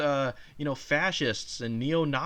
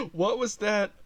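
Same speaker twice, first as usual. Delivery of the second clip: ordinary talk, nothing marked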